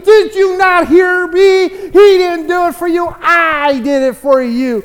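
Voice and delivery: high, loud indignant shouting; acted neighbor at the end of his patience; exasperated atheist. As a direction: yell it in frustration, demanding to be heard. Atheist exasperated at not being heard.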